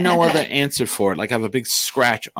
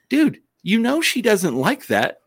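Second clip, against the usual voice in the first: mock scolding; 12 semitones higher, slower than usual. Scolding the cat.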